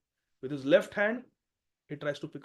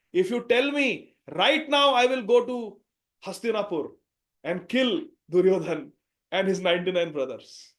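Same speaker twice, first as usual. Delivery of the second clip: fierce loud vow; 3 semitones higher, 9 dB louder than usual. Acted. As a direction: roar out the promise to your brother. Hanuman offers to kill Duryodhan.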